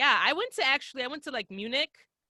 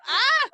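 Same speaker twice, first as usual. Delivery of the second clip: mock scream; 6 dB louder than usual. Retold scared reaction.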